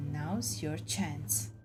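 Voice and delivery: ordinary delivery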